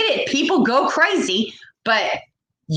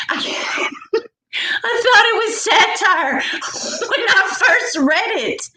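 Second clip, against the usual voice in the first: laughing disbelief; 11 semitones higher than usual. Real, not acted. Laughing at absurd news.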